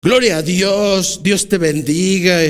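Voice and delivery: mock pious listener. Preacher mimics churchgoer dismissing Pablo, mildly mocking. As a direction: brush off the preacher with a hint of false piety. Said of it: "gente a la cual estuviera hablando Pablo".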